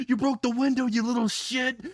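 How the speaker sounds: high-pitched angry yell; screaming. A narrator's reading. Inko yelling after the kid who broke the window, screaming. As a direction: holler it in a high, outraged voice. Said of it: "and then screams".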